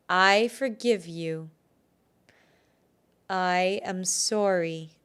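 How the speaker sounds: slow, deliberate phrases, long pauses; repeat-after-me affirmation. Shown as a demonstration. Healer leads listeners before cutting the cord, slow deliberate affirmation. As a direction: lead them phrase by phrase, slowly. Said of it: "So repeat after me".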